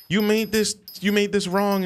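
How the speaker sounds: high voiced complaining server; mock server. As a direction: act out the annoyed server. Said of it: "she's like".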